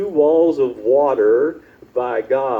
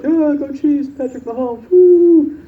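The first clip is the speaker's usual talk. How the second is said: high excited cheer, rising to a loud woo; cheering fans; 6 dB louder than usual. Retold or imitated. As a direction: whoop it up like a passing fan. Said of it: "everybody like".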